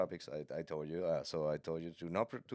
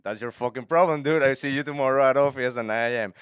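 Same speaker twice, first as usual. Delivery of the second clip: loud, raised-pitch mock outburst; acted defiant retort; 6 semitones higher, 11 dB louder than usual. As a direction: throw it back at the boss, loudly. Employee's retort in acted quarrel.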